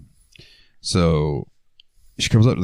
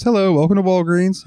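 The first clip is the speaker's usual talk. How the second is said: mock cashier greeting; 11 semitones higher than usual. Shift lead greeting customer.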